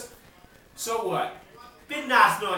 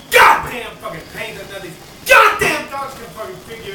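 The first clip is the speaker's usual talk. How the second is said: loud angry yell; furious shout; 10 dB louder than usual. Real, not acted. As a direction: let rip at the useless doctors. Ranting about vets and pains.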